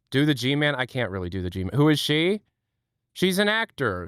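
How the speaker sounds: normal talking voice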